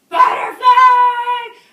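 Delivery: high, long-drawn loud calls; screaming full volume. Retold or imitated. Visitors calling the tree sitter at six a.m., full-lunged scream. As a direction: scream the name, stretching each call. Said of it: "screaming at the top of their lungs".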